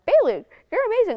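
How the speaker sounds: high-pitched praise from a teacher; gushing teacher. Retold or imitated. Girl quoting her teacher's praise of her, high and gushing. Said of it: "my teacher told me something like".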